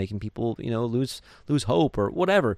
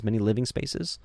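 ordinary talk

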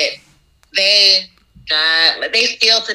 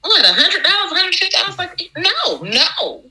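much higher playful back-and-forth; high mock voices; 9 semitones higher than usual. Baker acting out DJs asking and her refusal, high and teasing. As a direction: replay the callers and yourself in a high teasing voice. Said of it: "And they're like".

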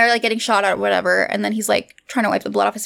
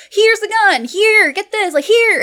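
tag; 9 semitones higher, slower than usual. Retold or imitated. Bickering demonstration of calling "here.

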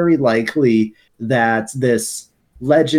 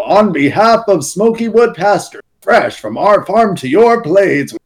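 high, loud salesy company voice; corporate pitchman; 7 semitones higher, 5 dB louder than usual. Retold or imitated. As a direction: sell it like a cheery holiday ad. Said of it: "they literally say".

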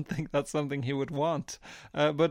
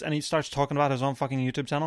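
usual speaking voice

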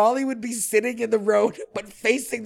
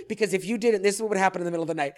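ordinary talk, nothing marked

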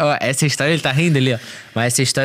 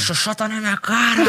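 drugged ranting; 7 semitones higher than usual. Retold or imitated. Retelling his own drugged ranting.

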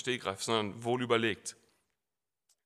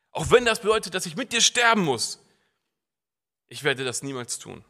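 Peter's vow again; 6 dB louder than usual. Retold or imitated. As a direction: restate it with conviction. (Petrus' Schwur, laut wiederholt)

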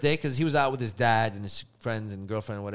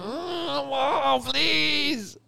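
higher, whiny plea; mortified pleading; 12 semitones higher, much slower than usual. Retold or imitated. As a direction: beg mom to stop, squirming. Begging embarrassing mom.

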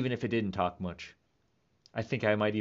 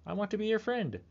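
high, small pleading candy voice; talking candy; 10 semitones higher than usual. Pretend. Chocolate pumpkin pleading not to be eaten, high and small. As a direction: make the chocolate beg sweetly. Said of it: "They would say".

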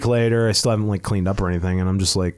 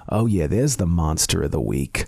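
lightly voiced older woman, lower pitch; a hint of her low, admiring voice; woman across the room; 7 semitones lower than usual. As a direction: drop low to imitate her. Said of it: "her going from across the room".

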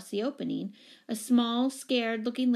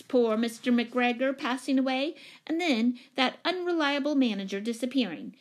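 commanding lady; 4 semitones higher, 5 dB louder than usual. Acted. Upset commanding tenant receiving the new owner, imperious. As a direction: hold forth loudly and imperiously.

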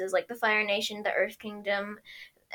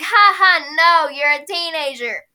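mocking villain; 4 semitones higher, 9 dB louder, slower than usual. Zhao voiced mocking.

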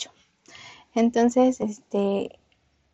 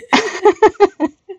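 giggling laugh; 10 semitones higher, 8 dB louder than usual. Real, not acted. Laughing after asking listeners to guess what she chose. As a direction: burst of high, louder laughter. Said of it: "adivinen qué elegí".

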